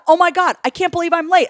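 high, mildly frantic self-talk; acted panic. Voicing panicked morning self-talk.

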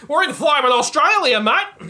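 put-on Australian accent. Announces Australian setting.

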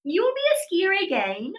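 high-pitched South African doctor imitation; imitated South African doctor's promise; South African accent. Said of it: "he just says to me in his accent".

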